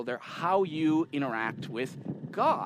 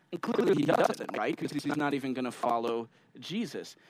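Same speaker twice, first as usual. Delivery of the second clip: normal talking voice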